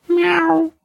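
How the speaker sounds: high mewing meow; cat meow. A narrator's reading. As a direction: mew like a kitten. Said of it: "кто-то сказал". (кошачье мяуканье)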